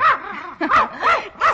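loud, high trilling laugh; a delighted high laugh. Acted. Woman laughs loudly as her dog takes to the stranger.

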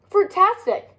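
high, bright cheer of delight; excited cry. A narrator's reading. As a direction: cry it out with joy. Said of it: "cried their friends".

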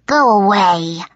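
snarled warning, drawn out. A narrator's reading. Fake Ethan warding off visitors through the door, snarling. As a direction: snarl it like a creature behind a door. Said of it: "snarled the voice from within".

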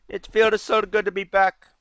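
put-on Arabian accent at moderate volume; attempted Arabian accent. Shown as a demonstration. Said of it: "let me try that again".

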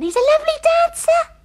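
high, loud swooning gush; gushing delight. Real, not acted. Girl gushing to a friend about her dance partner, swooning. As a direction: swoon over him out loud.

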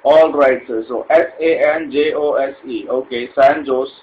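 ordinary talk

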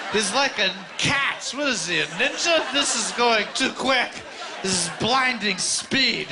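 high, drawn-out mock amazement; awestruck, hammed-up high voice; amazed onlookers. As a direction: stretch the words in high-pitched disbelief. Acting out amazed opponents.

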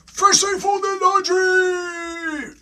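very high, slow wailing call; mock-dramatic announcement. A narrator's reading. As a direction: stretch it into a high, theatrical cry.

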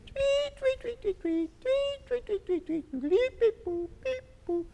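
very high chirping tweets; birdsong imitation. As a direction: chirp like a little bird. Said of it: "That's the bird singing".

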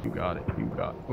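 very low, soft aside; low, quiet repeat. Real, not acted. Streamer quietly handing it over to the game, low murmur. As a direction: drop it deep and quiet. Same words, dropped low.

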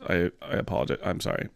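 usual speaking voice

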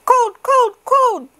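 put-on very high, loud baby voices; very high cold baby chant; shivering babies. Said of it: "said the shivering babies".

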